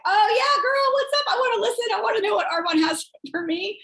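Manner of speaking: high, hammed-up keen friend; mock eager. Imagined [name] answering a party invite, playfully eager.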